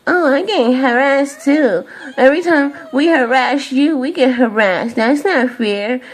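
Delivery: high, mocking whiny voice, half-laughing; mocking whine. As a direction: parody their complaint with a snicker. Mocking others who claim victimhood.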